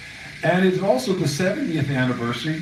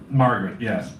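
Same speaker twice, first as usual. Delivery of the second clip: ordinary delivery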